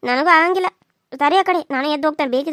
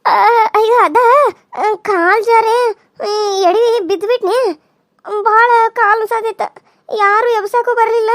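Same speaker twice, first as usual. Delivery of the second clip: slow, louder, tearful telling; sobbing story; 3 semitones higher, 4 dB louder, slower than usual. Acted. Wife tells her husband how she slipped and fell: slow sobbing. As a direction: drag the words out through sobs.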